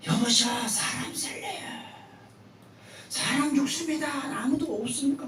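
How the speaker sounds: high, pleading cry for rescue; calling for help. Acted. Young man hanging from a tree cries for help, high pleading call. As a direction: call out to anyone who might hear. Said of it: "소리를 칩니다".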